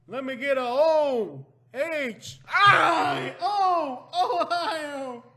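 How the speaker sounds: high, shouted spell-out cheer; mock cheer. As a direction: lead a rowdy cheer letter by letter.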